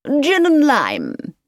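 high, drawn-out character voice; Mrs Cardew Trench. A narrator's reading. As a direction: order slowly in a high-pitched voice. Cardew Trench orders her drink.

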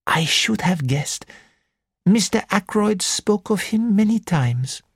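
put-on high, lilting French-accented gentleman; a fussy Belgian detective, high and precise; French accented little detective. Porrett speaks.

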